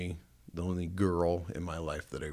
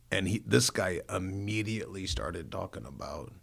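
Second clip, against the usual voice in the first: ordinary delivery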